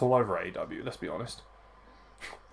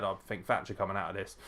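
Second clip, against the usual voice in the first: usual speaking voice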